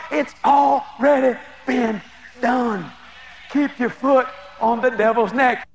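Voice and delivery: drawn-out words with weighty pauses; slow and emphatic. Real, not acted. Preacher closing the chicken lesson for believers, slow and emphatic. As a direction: land each word separately. Slows down to land the moral.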